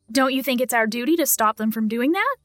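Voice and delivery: usual speaking voice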